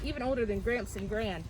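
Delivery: ordinary delivery, nothing marked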